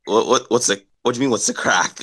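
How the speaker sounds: baffled newcomer. Retold or imitated. Cousin puzzled by local slang, baffled echo. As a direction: stumble over the unfamiliar phrase, mildly puzzled. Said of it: "And he's like".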